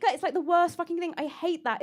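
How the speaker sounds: ordinary delivery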